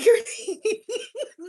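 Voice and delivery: high, far-off burst of laughter; real high giggling. Real, not acted. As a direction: let a big laugh burst out. Cracking up at own joke.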